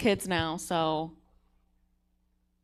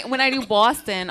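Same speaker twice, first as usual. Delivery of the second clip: Boston accent; 4 semitones higher, 9 dB louder than usual. Shown as a demonstration. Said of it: "get into my Boston accent".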